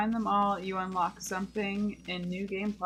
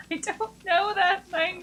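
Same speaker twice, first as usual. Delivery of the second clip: laughing; 10 semitones higher, 6 dB louder than usual. Real, not acted. Laughing at unreadable text.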